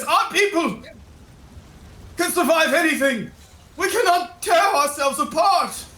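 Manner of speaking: high, impassioned townsperson; rallied crowd. Acted. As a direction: voice a roused citizen rallying the others.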